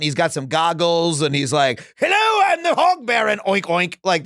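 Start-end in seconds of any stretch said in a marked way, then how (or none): 2.0-4.0 high shouted cartoon villain greeting; overacted anime dub. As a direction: ham it up like an anime hog villain. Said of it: "It sounds like an anime character".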